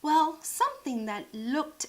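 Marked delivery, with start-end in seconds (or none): none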